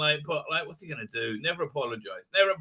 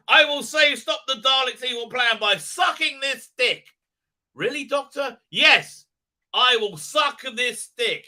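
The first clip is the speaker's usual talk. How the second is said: hammed-up heroic declaration, higher and partly shouted; mock Doctor Who dialogue; 6 semitones higher, 6 dB louder than usual. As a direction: ham up a silly hero line. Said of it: "I don't think Doctor Who will say".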